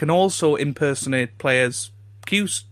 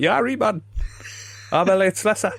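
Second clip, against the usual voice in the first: higher, casual mock Elliot Slesser; colloquial Slesser imitation; 6 semitones higher than usual. Imitating Elliot Slesser for the host, casual and higher. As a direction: toss off the name like a lad, a bit higher. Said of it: "a lot more colloquial than that".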